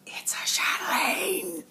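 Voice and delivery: slow breathless whisper; awed whisper. Real, not acted. Vlogger revealing the gift pattern to viewers, awed whisper. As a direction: whisper it like you can't believe it.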